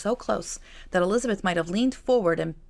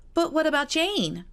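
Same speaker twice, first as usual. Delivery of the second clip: high, slow and questioning; light young girl's worry; young sister voice; 10 semitones higher, 3 dB louder than usual. Acted. Kitty to Elizabeth about Jane left behind, high and slow.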